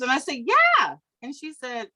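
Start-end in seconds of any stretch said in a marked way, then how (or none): 0.4-1.0 loud, very high insistence; emphatic retold yeah. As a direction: insist on hearing it, voice shooting up. Insisting she wants the truth.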